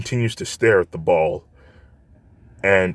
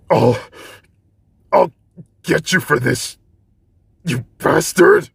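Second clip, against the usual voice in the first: much higher, louder, slowing on the insult; angry threat; 9 semitones higher, 5 dB louder than usual. Acted. Bakugo drained on the ground threatening Izuku: furious vow. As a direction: vow revenge through exhaustion. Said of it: "grows very angry".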